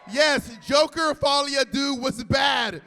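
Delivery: high, slow, loud showy defence; grand mock proclamation. Host mock-pardons a pop star, grand loud proclamation. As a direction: draw it out and belt it to the room.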